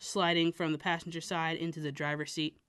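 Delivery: ordinary delivery, nothing marked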